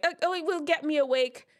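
high, quick mock-eager voice; light, hurried imitation of a keen listener; imagined eager objector. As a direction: pipe up like someone making an excuse. Voices an eager viewer's excuse.

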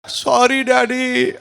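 high, very slow sobbing sorry; tearful apology. Retold or imitated. Son begging his father's pardon, tearful and slow. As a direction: drag it out like a weeping boy. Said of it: "ఏడుస్తూ క్షమాపణ కోరాడు".

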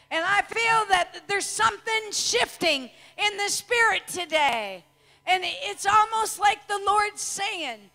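loud, rising revival preaching, shouted at the peaks; preaching at full force. Acted. Preacher rousing the congregation, shouting. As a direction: preach it out hard to the crowd.

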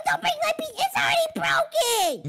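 upset protest. Acted. Pleading as PC gets broken.

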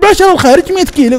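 very high-pitched astonished question; incredulous. Retold or imitated. New driver questioning ten riyals for a long trip, incredulous. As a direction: shoot the pitch up in disbelief. Retold disbelief at the low fare.

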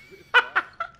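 high, loud burst of laughter. Real, not acted. Laughing at where it happened, a high loud laugh.

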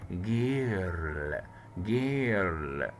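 جيرل said slowly with a hard g, as ger-l; slow demo girl. Shown as a demonstration. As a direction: stretch جيرل out, hard g at the start. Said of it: "جي، كسرة اهو".

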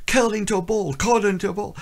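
high-pitched inner voice; mock-panicked, squeaked-up repeated thought; retold panicky brain. Another part of the brain wanting to hide, high retold panic. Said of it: "Another point says".

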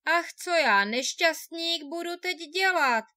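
poor man lamenting alone on the hill. A narrator's reading. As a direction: cry out your misery to the cold wind. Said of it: "naříkal si".

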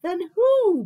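loud, high bellow; big booming-loud demand; moose bellow. A narrator's reading. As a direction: bellow the who. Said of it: "bellowed Moose".

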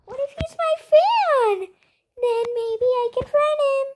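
very high, squeaky excitement; high-pitched fangirl thrill; excited hope. Real, not acted. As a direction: squeal the hopeful idea. Excited at a possible fan.

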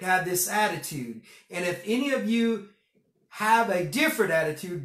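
reading scripture aloud with preaching force. Acted. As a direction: lift the verse up to the congregation.

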